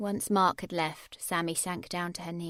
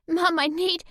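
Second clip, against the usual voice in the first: high, louder, voice close to breaking; near tears; 9 semitones higher, 10 dB louder than usual. A narrator's reading. Sammy begging her mum, close to crying. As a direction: plead through held-back tears.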